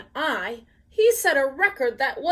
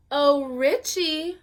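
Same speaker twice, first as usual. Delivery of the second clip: sweet, slow coaxing call; cooing; 4 semitones higher, 5 dB louder, slower than usual. Acted. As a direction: coo his name sweetly, slowly. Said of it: "I cooed".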